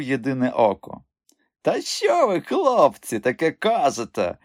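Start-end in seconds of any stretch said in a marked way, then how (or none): 1.6-4.4 high-pitched, indignant protest; wounded, high-voiced indignation; sincere indignation. A narrator's reading. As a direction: protest your innocence, pitch jumping up. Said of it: "щиро обурювався він".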